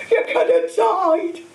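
loud, high, drawn-out tearful wail; sobbing. A narrator's reading. Hagrid horrified Harry nearly died, sobbing. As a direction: wail slowly through acted sobs. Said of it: "sobbed Hagrid".

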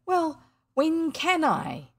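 put-on young William pestering his mother; pleading boy. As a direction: wheedle like a small boy.

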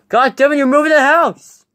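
high-pitched cry of annoyance; exasperated outburst. Acted.

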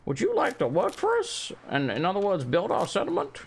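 hammed-up sweet farmer voice; mock NPC voice. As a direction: imitate an overly polite game character. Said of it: "maybe they're like".

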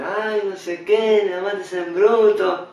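slow, drawn-out mocking voice; sneering slow imitation of a stock phrase. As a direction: parody the cliché, dragging each word. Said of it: "A decir".

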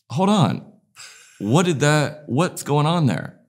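high-pitched, puzzled double take; retold bewilderment. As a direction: act out stopping short at a strange sight. Retelling his puzzlement at truck.

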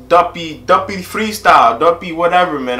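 usual speaking voice

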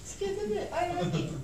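high, bright-eyed cheer; cheerful demo. Shown as a demonstration. Demonstrating cheerfulness to the audience, high and cheerful.

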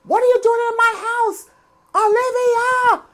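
furious wife yelling at her husband's mistress; yelling berserk. Retold or imitated. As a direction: scream it down the corridor like a wife gone berserk. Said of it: "She's yelling out in the corridor".

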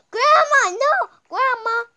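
high, loud gasping cry; pretend alarm. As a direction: pretend panic calling out. Pretend play, alarm at grandma.

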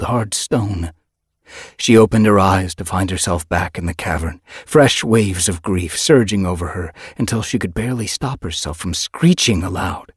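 ordinary delivery, nothing marked

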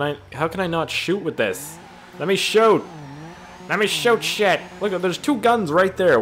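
loud, high frustrated outburst; exasperated. Real, not acted. Frustrated that guns will not fire.

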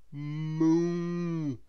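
long drawn-out moo; cow mooing. A narrator's reading. A cow answers a request for directions, mooing. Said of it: "replied the cow".

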